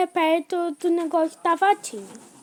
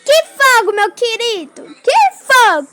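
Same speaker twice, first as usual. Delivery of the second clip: exasperated yelling; 5 semitones higher, 8 dB louder than usual. Real, not acted. Panicked about the fire gone out.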